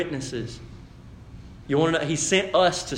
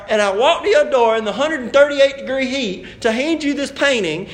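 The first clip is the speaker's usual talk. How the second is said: louder, higher hammed-up outrage; mock indignant rant; 7 semitones higher, 6 dB louder than usual. Painter scolding the friend who refused his gift, in hammed-up outrage. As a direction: rant like an insulted painter storming off. Acting the insulted gift giver.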